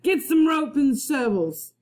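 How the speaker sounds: a captain roaring at his pirate crew. Acted. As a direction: bellow orders at the crew. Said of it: "he roared at his men".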